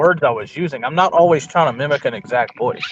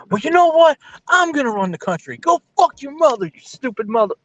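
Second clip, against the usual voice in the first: mock Cherry rant; 11 semitones higher than usual. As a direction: pop off at the rival like a hothead. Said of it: "Cherry will be like".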